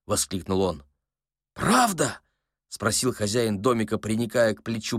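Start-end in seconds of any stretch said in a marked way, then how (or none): 1.5-2.2 put-on high-pitched, plaintive old man; old man's hopeful question. A narrator's reading. Old man to his sympathiser, high hopeful voice. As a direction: ask it high, longing to believe.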